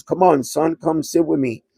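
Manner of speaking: a lightly voiced, coaxing mom.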